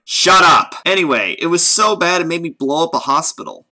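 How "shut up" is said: loud snap; shouting. Acted. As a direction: cut him off with a yell. Snapping at interrupter.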